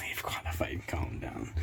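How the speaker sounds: whispered aside. Acted.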